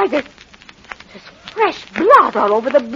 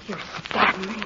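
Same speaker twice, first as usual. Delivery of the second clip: faint dying voice; 5 semitones lower, 12 dB quieter than usual. Acted. Dying wife speaks.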